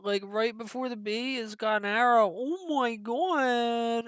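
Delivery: high-pitched, loud burst of excitement; excited gushing. Real, not acted. Reacting to the new logo and its arrow, high excited gushing. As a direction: squeal over it, voice way up high.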